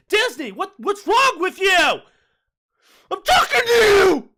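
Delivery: high, full-volume hollered rant; mock furious yell. Host rants at Disney over lightsabers, yelling. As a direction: yell it out, drawing the last words long.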